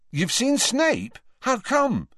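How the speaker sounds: high, slow, incredulous question; mouth full. A narrator's reading. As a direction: ask it through frantic mouthfuls of cake. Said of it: "between frenzied mouthfuls of gatto".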